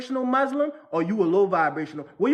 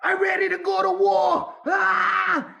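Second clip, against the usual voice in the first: high, loud hammed-up battle yell; hammed-up zealot yelling for war; mock war cry; 11 semitones higher, 3 dB louder, slower than usual. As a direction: shout like a comic zealot ready for war. Mocking the war-ready spiritual men.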